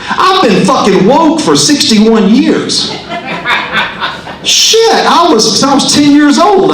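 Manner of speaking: high, laughing declaration, slowing down; laughing punchline. Acted. Comic to the crowd, laughing at himself. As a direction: crack up on your own confession.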